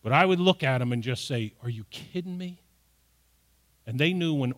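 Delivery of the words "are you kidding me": quiet, serious parental disbelief; lightly voiced stern parent; serious parent rebuke. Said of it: "they knew ... that I was serious".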